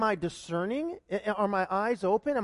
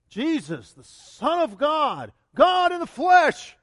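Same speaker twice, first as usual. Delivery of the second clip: slow, louder, higher proclamation; preaching shout; 5 semitones higher, 7 dB louder, slower than usual. Real, not acted. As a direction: shout it out to the congregation. Preacher stresses Jesus' divinity.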